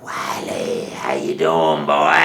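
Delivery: low, loud mocking monster voice with laughter; monster taunt. Hairy man taunting Wiley up the tree, loud low mocking. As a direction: growl it out and cackle.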